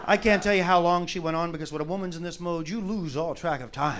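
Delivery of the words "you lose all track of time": usual speaking voice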